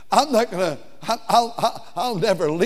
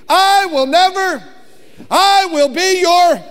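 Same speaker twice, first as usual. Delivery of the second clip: climactic preaching; 10 semitones higher, 6 dB louder than usual. Acted. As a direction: drive the refrain home to the crowd. Preaching peak with congregation.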